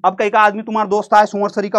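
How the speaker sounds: ordinary delivery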